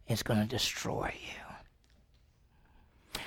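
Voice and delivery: slow, low menacing whisper; ominous whisper. Acted. God warning Cain about sin, slow menacing whisper. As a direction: breathe the warning, drawn out.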